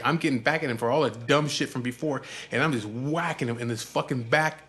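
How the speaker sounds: usual speaking voice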